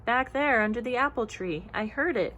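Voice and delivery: ordinary delivery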